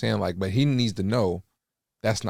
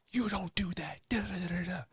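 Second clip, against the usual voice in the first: whispered scolding, higher and much softer; retold whisper; 10 semitones higher, 9 dB quieter than usual. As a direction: hiss it at the kid. Dad scolding his kid.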